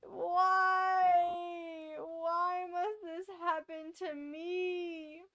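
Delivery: slow, high, theatrical despair; melodramatic lament. Pretend. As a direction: cry out to the heavens. Mock grief over dead pets.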